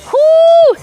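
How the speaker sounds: high, loud cheering whoo; excited whoo. Real, not acted. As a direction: cheer them on with a big whoo. Coach cheering during workout.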